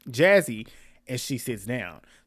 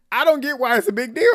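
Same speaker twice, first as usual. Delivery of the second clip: high, loud, exasperated; "I don't get why it's a big deal" thrown up high and loud; exasperated quote; 10 semitones higher, 7 dB louder than usual. Retold or imitated. Kay in her confessional, high and loud disbelief. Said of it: "She's like".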